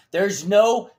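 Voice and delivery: slow, loud, high, emphatic outburst; heated insistence. Real, not acted. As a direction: pound out the disbelief loudly.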